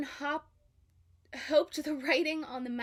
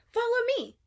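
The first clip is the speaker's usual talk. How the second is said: eager Dory; 12 semitones higher, 8 dB louder than usual. A narrator's reading. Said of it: "she said".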